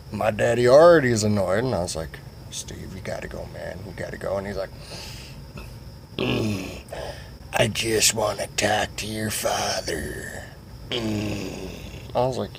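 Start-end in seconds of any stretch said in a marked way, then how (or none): 2.5-4.4 low, whispered warning; hushed urging. Retold or imitated. Urging Steve to leave secretly. 6.2-11.7 slow, soft, drawn-out creep voice; creepy slow character. Retold or imitated. Voices Goat Fucker Steve.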